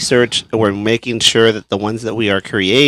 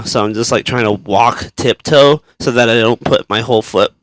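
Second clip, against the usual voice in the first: ordinary talk, nothing marked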